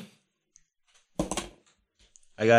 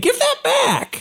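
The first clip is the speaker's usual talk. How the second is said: very high, slow demand; squeaky hammed-up protest; shrill demand; slower than usual. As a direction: grab for your wig. Player grabs for magic wig.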